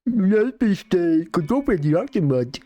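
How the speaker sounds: slow, slurred Danish caricature; mock Danish accent. Mocking Danish again, slow slurred Danish caricature. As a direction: drag it out in an exaggerated Danish impression.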